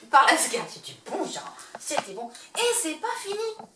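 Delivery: ordinary talk, nothing marked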